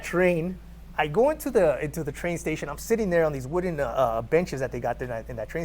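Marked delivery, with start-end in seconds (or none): none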